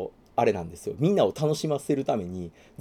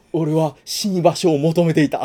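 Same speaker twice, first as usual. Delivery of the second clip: slower, louder heroic declaration; grim hero line; 4 semitones higher, 8 dB louder, slower than usual. Retold or imitated. Fan acting out Aramaki's line for co-host, slower and louder. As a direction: play the world-weary fighter.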